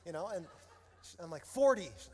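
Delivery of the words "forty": loud, raised-pitch single word; exasperated answer. Retold or imitated. Annoyed dad answering his daughter's game, loud. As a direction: toss it out loudly to get it over.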